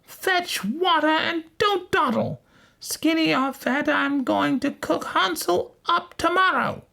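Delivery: very high, commanding witch voice; witch crying out. A narrator's reading. Witch ordering Gretel to fetch water, high cry. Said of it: "she cried out".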